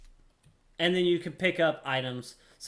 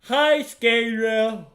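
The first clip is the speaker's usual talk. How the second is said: playful drawn-out greeting; 7 semitones higher, 8 dB louder, much slower than usual. Pretend.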